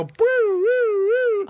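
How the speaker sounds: high, drawn-out "woo" siren noise; rising wailing whoops; imitated alarm whoops. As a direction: mimic an alarm going off with your voice. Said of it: "lights just go on and go".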